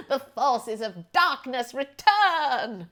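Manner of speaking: slow, ominous villain intoning; drawn-out laughing doom proclamation; Maleficent. Retold or imitated. Still teasing John, slow laughing villain proclamation. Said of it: "Like Maleficent".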